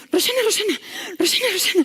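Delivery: high, panicked calling of a name; anxious panic. Retold or imitated. Night nurse bursts in calling the doctor, anxious panic. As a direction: imitate a nurse in an anxiety attack. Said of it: "con un ataque como de ansiedad".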